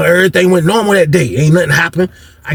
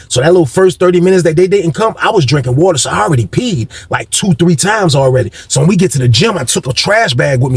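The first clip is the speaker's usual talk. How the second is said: ordinary talk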